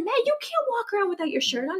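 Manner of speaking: high, fast mock scolding; scolding husband. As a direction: tell him off in a high hurried voice. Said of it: "And I was like".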